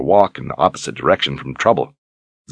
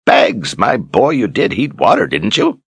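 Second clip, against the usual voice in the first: put-on loud, exasperated old man; louder old mentor, pressing; gruff old wizard, impatient; 3 semitones higher, 4 dB louder than usual. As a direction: scold the boy while hurrying. Zed scolding after bellow.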